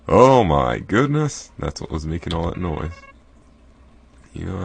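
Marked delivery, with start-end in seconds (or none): 0.1-1.5 slow loud gasping exclamation; surprised. Real, not acted. Player seeing the noisy beast, shocked. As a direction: draw it out in shock.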